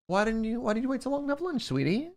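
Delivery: high, soft sweet-talk; hurried, high-pitched affectionate coo; tender concern. Real, not acted. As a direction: fuss gently over a loved one. Gently fussing over a loved one.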